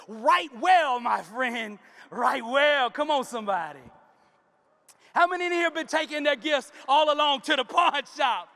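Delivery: rising fervent sermon delivery; preaching. Real, not acted. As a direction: preach it, pushing the congregation. Preaching at full force.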